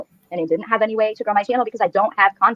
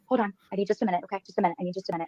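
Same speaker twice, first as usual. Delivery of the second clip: quiet, withdrawn; 11 dB quieter, faster than usual. Real, not acted. Asks for a minute, upset.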